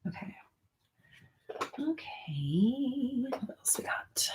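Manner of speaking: unvoiced whisper, slow and hushed. Real, not acted. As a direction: whisper it to yourself off mic. Whispering off mic.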